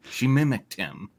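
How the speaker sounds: normal talking voice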